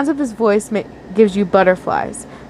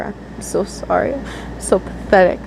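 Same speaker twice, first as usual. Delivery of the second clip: much softer, broken self-blame; almost swallowed by tears; tearful; 4 dB quieter than usual. A narrator's reading. Crying, blaming herself.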